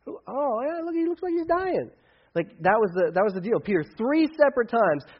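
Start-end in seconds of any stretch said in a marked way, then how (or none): 0.1-1.9 much higher, playing dumb about who that is; mock denial. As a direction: fake not knowing the guy, high and airy. Peter denying knowing Jesus.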